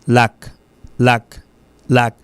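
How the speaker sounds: "lac" cut very short; isolated, brief "lac" with a short vowel; short syllable. Shown as a demonstration. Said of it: "c'est une syllabe brève".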